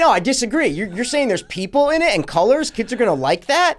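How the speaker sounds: high, fast mock protest; mock disagreement. Co-host acts out the other host's objection, mock disagreement. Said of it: "You're just like".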